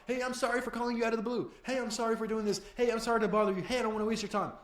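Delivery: high, pleading string of apologies; mock a grovelling cold caller, pitched well up; over-apologetic caller. Beginner apologising for calling out of the blue, over-apologetic. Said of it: "If you keep saying".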